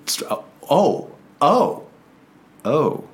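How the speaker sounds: normal talking voice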